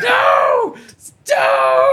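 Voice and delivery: put-on high loud childish cry; child begging mom not to call Santa, high and loud; mock panicked kid. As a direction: ham up a scared little kid. Imitating child fearing Santa call.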